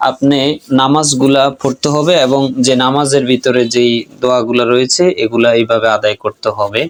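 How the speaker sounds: ordinary delivery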